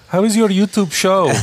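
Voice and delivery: high, slow grandma in a put-on Russian accent; mock Russian accent. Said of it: "I don't know why I made that accent".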